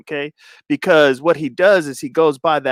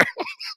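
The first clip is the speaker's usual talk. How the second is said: very high, soft wheezing laugh; breathless squeal of laughter; helpless laughter; 17 dB quieter than usual. Real, not acted. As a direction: laugh till no voice is left. Cracks up at own story.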